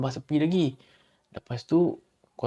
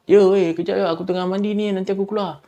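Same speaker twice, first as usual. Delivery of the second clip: loud, higher reply from the shower; shouting back; 7 semitones higher, 6 dB louder than usual. Retold or imitated. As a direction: shout back over the water. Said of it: "Dia pun". (menjawab sambil berteriak)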